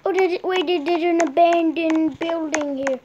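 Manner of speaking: normal talking voice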